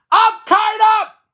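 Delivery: high, loud full-voiced shout to the sky. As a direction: yell it up at the kite. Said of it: "He shouted".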